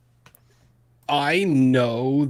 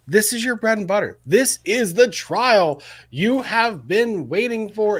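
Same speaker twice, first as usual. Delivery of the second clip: high, loud encouragement; excited hype; 9 semitones higher, 4 dB louder than usual. Real, not acted. Co-host hypes the host about his Rust trial, excited.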